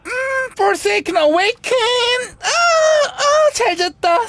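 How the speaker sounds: high, drawn-out undead wake-up cry; mock awakening. Player voicing the undead character rising, mock awakening. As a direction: proclaim it high, then stretch awake.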